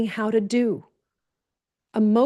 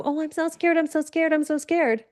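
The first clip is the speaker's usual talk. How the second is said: higher, mocking a scared whine; a high put-on voice, fretting over and over; mock scared whimper; 5 semitones higher than usual. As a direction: parody someone wallowing in fear. Mocking wallowing in fear.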